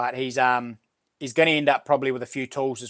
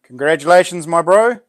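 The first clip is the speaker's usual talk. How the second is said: loud congratulations; 3 semitones higher, 8 dB louder than usual. Real, not acted. Congratulating a winner on stream.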